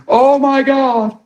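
drawn-out high mock gasp of fright; hammed-up freaked-out cry; mock panic. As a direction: imitate a panicked kid, slow and high. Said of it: "learn that fear is normal and freaking out".

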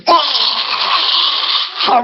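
high strangled ah; a lady's cry as she falls; mock cry of pain. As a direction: act a sudden shriek. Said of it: "this lady goes".

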